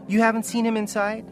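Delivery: high, anxious question; pleading mother. Acted. Mother asking guard about her son inside, pleading. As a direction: press for an answer, pitched high.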